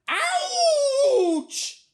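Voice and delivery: high, loud, hammed-up yelp; pained scream. A narrator's reading. Boy stung by a bee, screaming high and loud. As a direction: scream out a long storybook ouch. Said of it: "he screams".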